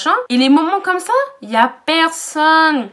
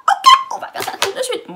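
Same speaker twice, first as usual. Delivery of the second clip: high, louder, ready for a fight; fired up; 13 semitones higher, 5 dB louder than usual. Retold or imitated. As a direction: challenge him head on. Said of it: "je me suis pas excitée comme ça".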